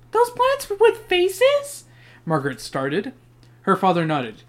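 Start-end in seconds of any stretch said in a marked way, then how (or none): 0.1-1.8 put-on high, slow girl's voice. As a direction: voice a young girl, high and drawn out. Margaret voiced in story.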